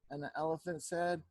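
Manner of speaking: ordinary delivery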